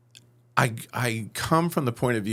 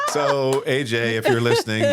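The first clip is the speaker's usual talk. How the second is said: laughing; 2 semitones higher, 5 dB louder than usual. Real, not acted. Still laughing.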